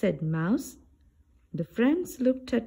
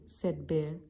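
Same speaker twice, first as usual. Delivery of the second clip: ordinary delivery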